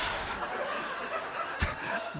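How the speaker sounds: airy squeaky laugh; high breathless laugh. Real, not acted. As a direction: burst into a wheezy laugh. Laughing while telling the story.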